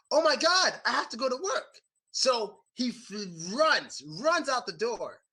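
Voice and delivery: friend panicking about being late for work; panicked. Retold or imitated. Friend wakes up late, panics about work.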